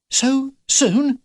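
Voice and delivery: high-pitched startled question; alarmed surprise. Acted. Philip learning the mission is imminent, alarmed. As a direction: pitch it right up, taken aback.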